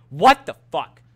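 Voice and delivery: loud, indignant burst; outraged outburst. Retold or imitated. Student refused the retake by teacher, loud outrage. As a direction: burst out loudly at the injustice.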